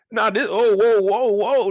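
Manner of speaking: surprised. Real, not acted. Reacting to chat teasing.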